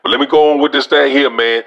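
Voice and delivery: ordinary delivery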